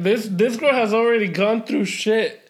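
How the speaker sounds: normal talking voice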